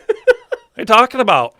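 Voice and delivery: high, loud incredulous outburst; retold disbelief. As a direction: blurt it in mock outrage while retelling. Retold startled reply to patient.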